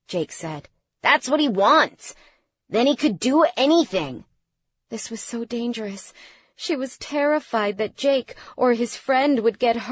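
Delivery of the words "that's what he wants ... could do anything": raised, higher-pitched warning; agitated, insistent objection; urgent protest. A narrator's reading. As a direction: argue back in alarm. Son argues urgently against stopping.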